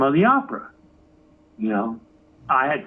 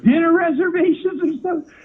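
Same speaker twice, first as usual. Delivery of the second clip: sarcastic disbelief; 10 semitones higher, faster than usual. Retold or imitated. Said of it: "I'm like".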